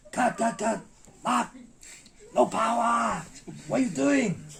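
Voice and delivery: high, loud mimicked director's tirade; retold angry shout. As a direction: yell at the student like a fed-up master. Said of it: "My master shouts".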